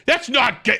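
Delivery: high, loud mock protest; offended reaction. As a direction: squeal the denial like you're offended. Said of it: "is to go".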